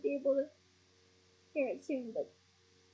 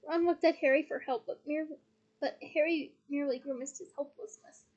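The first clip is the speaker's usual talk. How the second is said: ordinary delivery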